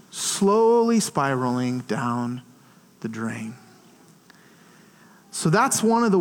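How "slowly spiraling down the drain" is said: drawn-out, sinking low; slow, low, fading away; slow descent. Real, not acted. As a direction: stretch it out, dropping to a hush. Acts out the slow downward spiral.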